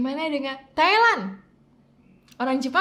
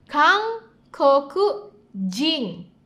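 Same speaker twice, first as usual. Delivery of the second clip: Nihon jing said slowly, stress on Nihon; slow model; much slower than usual. Shown as a demonstration. Teacher modelling the word for Japanese citizen, slowly. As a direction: stretch Nihon jing for learners. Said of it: "Warga negara Korea".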